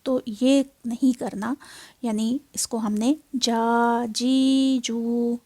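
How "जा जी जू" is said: जा जी जू stretched slowly; wrong drawn-out way. Shown as a demonstration. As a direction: drag जा जी जू out long. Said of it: "ऐसे नहीं पढ़ना".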